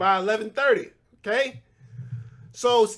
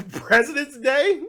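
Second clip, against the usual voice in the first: amused disbelief, slow and laughing; 7 semitones higher, 4 dB louder than usual. Real, not acted. Host scoffing at Presidents Day, laughing slowly. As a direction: laugh through it, drawn out and higher.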